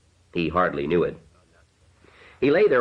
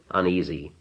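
usual speaking voice

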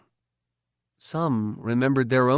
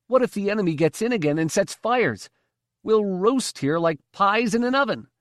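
frightened; 9 semitones higher than usual. A narrator's reading. Frightened slave complaining.

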